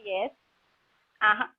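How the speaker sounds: normal talking voice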